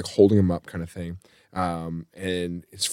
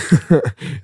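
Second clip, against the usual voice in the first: short, higher awkward giggle; nervous laugh; 5 semitones higher than usual. Shown as a demonstration. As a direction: laugh as if you missed the words. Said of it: "if I didn't hear you".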